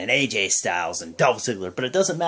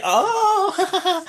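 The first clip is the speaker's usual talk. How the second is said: laughing oh; 4 dB louder than usual. Retold or imitated. Reaction to a joke.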